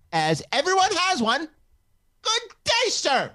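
very high-pitched, pompous mock voice; imagined Twitter complainer. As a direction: put on a squeaky indignant letter-writer, pausing between phrases. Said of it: "he's just looking at his Twitter and he goes".

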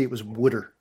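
Wooder, low and soft as WOOD-er; mild Philly Wooder; Philly water. Shown as a demonstration. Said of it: "if they say water".

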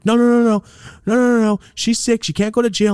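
begging the cop to spare her; high panicked pleading. Retold or imitated. Friend pleading for wife.

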